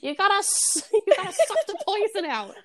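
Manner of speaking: high, giggling through the words; laughing quote. Retold or imitated. Co-host quoting kids fixing Eddie, giggling high. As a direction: laugh while quoting the line.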